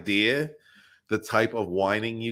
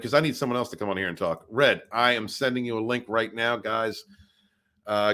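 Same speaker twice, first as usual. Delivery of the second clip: ordinary talk, nothing marked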